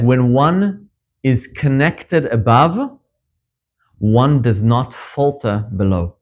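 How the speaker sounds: slow, weighty quoting of the saying. Shown as a demonstration. Rabbi citing Yonatan to the congregation, slow and weighty. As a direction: lay out when one is connected above slowly. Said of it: "Yonatan, he said".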